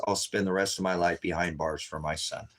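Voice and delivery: ordinary delivery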